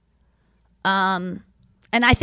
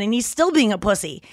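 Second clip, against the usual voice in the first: ordinary delivery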